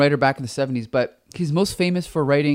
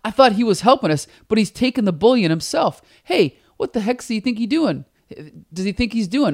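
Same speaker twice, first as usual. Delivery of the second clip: lightly voiced, raised pitch guard complaining; indignant guard; 6 semitones higher than usual. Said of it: "then the one guy's like".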